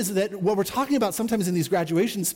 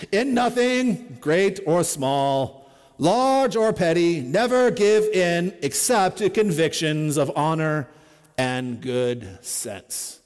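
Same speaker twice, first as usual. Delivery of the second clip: put-on very slow, louder, measured oratory of an old statesman; Winston Churchill voice; 4 dB louder, slower than usual. Pastor finishes reading Churchill's speech, in a Churchill voice. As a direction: weigh each phrase like a defiant wartime leader. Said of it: "I'll try to read it in my best Winston Churchill voice".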